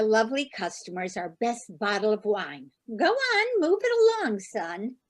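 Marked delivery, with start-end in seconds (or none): 3.0-5.0 high pushy shooing. Acted. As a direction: hurry him off, high and bossy. Mother shooing son away.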